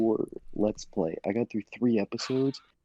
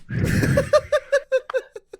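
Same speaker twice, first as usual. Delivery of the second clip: loud high-pitched cackle; high shrieking laugh; 7 dB louder than usual. Real, not acted. Laughter burst.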